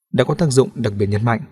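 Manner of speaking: usual speaking voice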